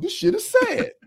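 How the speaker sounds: squeaky mock-weeping replay; exaggerated mock sob. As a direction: overplay the crying for laughs. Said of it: "That was the words out of my mouth".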